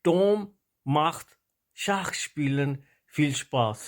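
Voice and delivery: word by word with pauses between; reading practice sentence. Shown as a demonstration. Teacher reads the German exercise for learners, word by word. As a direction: read the German sentence as a slow, separated model. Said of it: "وہ میں آپ کو پڑھ دیتا ہوں".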